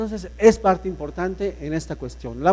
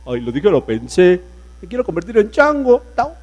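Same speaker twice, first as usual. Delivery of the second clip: cartoon voice impression; 2 semitones higher, 5 dB louder than usual. Shown as a demonstration. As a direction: ham up a cartoon character. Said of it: "imitar la voz que se les ocurra". (imitación de personaje de caricatura, exagerada)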